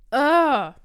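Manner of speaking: high, loud groan of disgust; loud disgusted ugh. Real, not acted. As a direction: gag at the taste. Reacting to disliked pumpkin caramel.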